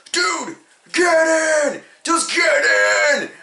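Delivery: high, drawn-out shout; urgent yell. Acted. Driver calling his friend into the car, urgent yelling. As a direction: yell it way up high, stretching the words.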